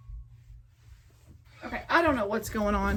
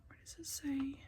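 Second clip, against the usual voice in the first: whispered to herself; 4 semitones higher, 15 dB quieter than usual. Real, not acted. Puzzling over instructions, to herself.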